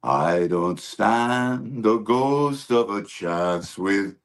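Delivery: slow crooning [name] voice; slow, drawn-out Sinatra croon; Sinatra impression. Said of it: "I do like that".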